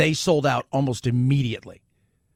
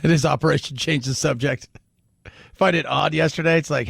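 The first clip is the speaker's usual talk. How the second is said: ordinary talk, nothing marked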